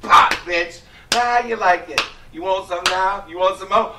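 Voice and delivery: loud, mocking taunt; taunting slapper. Host plays [name] taunting the woman, loud and cocky. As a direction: jeer at her, loud and cocky. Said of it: "acting out [name]".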